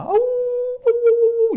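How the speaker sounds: high, long drawn-out dog whimper howl. Acted. Storyteller howling as the crying dog. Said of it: "El perro lloraba".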